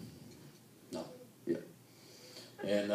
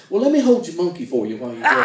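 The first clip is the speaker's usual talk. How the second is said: punchline delivery; 3 semitones higher, 7 dB louder, faster than usual. Acted. Punchline of the joke.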